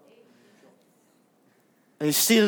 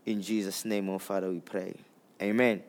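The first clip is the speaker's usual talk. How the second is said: soft, low closing prayer; quiet prayer; 13 semitones lower, 11 dB quieter, faster than usual. Real, not acted. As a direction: murmur the end of a prayer.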